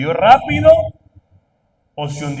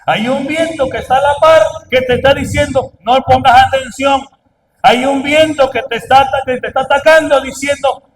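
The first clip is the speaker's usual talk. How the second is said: loud, higher preaching voicing the wind; forceful preaching; 6 semitones higher, 8 dB louder than usual. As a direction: press each warning harder.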